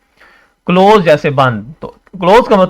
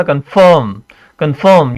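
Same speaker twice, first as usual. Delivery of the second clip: Confirm with a long, emphasized second syllable; stressed syllable. Shown as a demonstration. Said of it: "stress दे रहे हैं".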